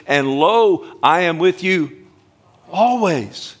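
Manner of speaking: high and loud, proclaiming the promise; soaring emphatic preaching; rising preacher's cry. Acted. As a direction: lift it up and ring it out over the church.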